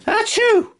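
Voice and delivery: high squeaky sneeze; rabbity sneeze. Acted. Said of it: "a rabbity sneeze".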